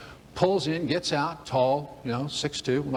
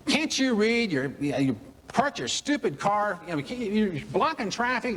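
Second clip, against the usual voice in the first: higher, snappish imitation of the parking attendant; mock angry attendant; 6 semitones higher, slower than usual. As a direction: play the rude attendant scolding you, not full volume. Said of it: "He starts yelling at you".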